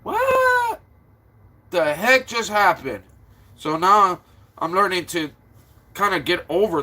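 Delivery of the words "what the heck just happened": a squeaky high burst on What, then the rest plain; retold shock, What squealed high. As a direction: caught off guard, voice jumps up. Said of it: "And I'm like".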